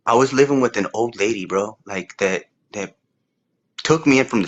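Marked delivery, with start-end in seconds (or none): none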